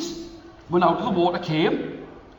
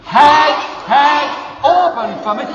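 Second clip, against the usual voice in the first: high, loud chant with a laugh; shouted spell; 8 semitones higher, 11 dB louder than usual. Shown as a demonstration. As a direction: shout it together with the kids. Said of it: "Can you say that with me".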